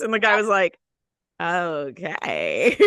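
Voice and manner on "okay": drawn-out, dubious imitated okay; taken-aback okay. Imitating ride worker answering her outburst, taken aback. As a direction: stretch out a wary okay.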